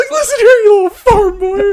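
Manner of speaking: very high, loud mock falsetto. Host playing Lex threatening Clark, hammed-up falsetto. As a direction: squeal a threat in falsetto, nearly laughing. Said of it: "He just turns into [name]".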